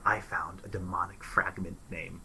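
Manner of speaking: hushed confession; whispering. Acted. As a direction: whisper it like a secret. Character confides secret.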